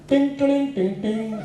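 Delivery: playful ting ting sound effect, laughing; mock payment chime. Teacher imitates the scan-and-pay beep for the kids, laughing. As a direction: mimic the phone chime with a laugh. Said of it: "और मैंने ऐसे किया".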